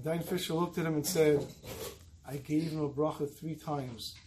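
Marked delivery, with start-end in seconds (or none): 2.2-4.1 softer, slow, solemn reply; grave and quiet. Retold or imitated. Dayan quietly answering the accusing son, solemn. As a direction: drop the voice and let it land.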